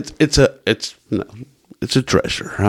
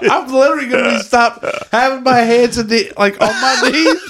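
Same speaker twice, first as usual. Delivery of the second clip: laughing; 11 semitones higher than usual. Pretend. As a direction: giggle through it breathlessly. Laughing as exhausted runner.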